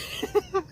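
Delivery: squeaky burst of laughter; real helpless giggle; high-pitched laugh. Real, not acted. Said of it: "I'm laughing so hard".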